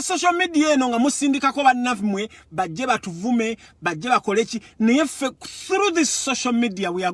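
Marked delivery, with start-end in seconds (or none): none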